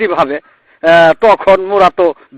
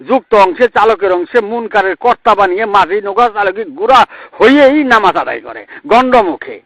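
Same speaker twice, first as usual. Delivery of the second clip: preaching at full force; 4 semitones higher than usual. Acted. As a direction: lift it into a ringing sermon chant. Preacher raises chant to crowd.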